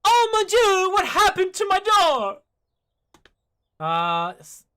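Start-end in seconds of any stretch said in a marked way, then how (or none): 0.0-2.4 high, loud French chef voice. Acted. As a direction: shriek it like a flustered French chef. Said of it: "what's this voice?".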